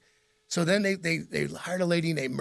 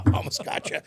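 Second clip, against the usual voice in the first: imitated gleeful laugh; 2 semitones higher than usual. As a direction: play the prankster popping out from under the desk, laughing. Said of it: "he'd stand up and be like".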